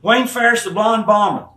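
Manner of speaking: mocking. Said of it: "I said".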